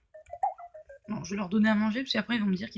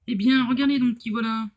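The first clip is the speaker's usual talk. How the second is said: méchant théâtral, fort et moqueur; mustache twirling villain; 5 dB louder than usual. Acted. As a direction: gloat like a cartoon villain. Méchant du jeu, voix jouée. (méchant de dessin animé qui ricane)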